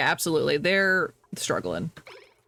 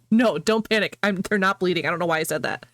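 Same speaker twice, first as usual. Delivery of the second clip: normal talking voice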